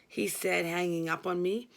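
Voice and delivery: usual speaking voice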